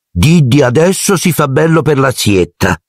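doting aunt. Acted. Aunt preening her son for the visit, cooing. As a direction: fuss over the boy like a proud mother.

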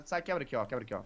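ordinary delivery

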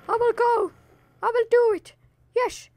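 high, cartoon squeak; a squeaky high character voice. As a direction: pipe up like a tiny creature. Squeaky put-on voice.

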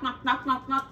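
ordinary delivery

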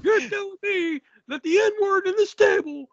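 high incredulous character voice; lightly voiced character in disbelief; mock incredulous question.

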